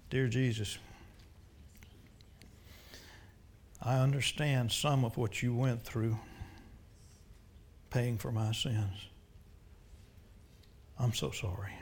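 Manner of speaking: quiet penitent prayer; led prayer. Real, not acted. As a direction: pray it humbly with them. Leads a prayer.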